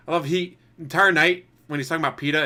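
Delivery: ordinary talk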